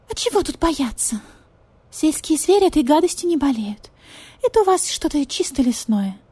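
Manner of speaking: pleading, sorry for herself; pitiful cat lady. Acted. Marquise, higher voice.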